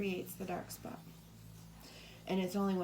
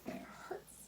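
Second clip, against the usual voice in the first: whispered echo; 10 dB quieter than usual. Retold or imitated. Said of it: "it hurts, they say".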